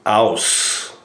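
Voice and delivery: aus as OWSS, no T at the end; German aus. Shown as a demonstration. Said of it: "But there is no T".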